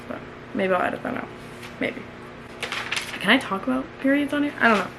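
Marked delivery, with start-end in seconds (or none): 0.1-2.0 muttered half to herself; under her breath, unsure; whispered aside. Real, not acted. Aside about editing it out.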